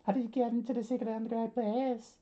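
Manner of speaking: very high, fast falsetto; high-pitched put-on voice, rushed; startled character demand. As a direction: squeak it out in a rapid falsetto. Acted character challenging an intruder.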